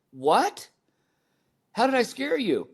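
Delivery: high-pitched, astonished question; taken aback. Retold or imitated. Dad learning he scared his son, taken aback. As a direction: act out startled disbelief, pitch leaping up. Acting out shock at son's remark.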